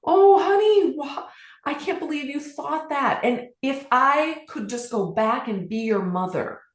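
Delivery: put-on a mother's reassurance to her little girl; comforting the little girl she once was, tenderly; tender reply. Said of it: "strong desire to answer her".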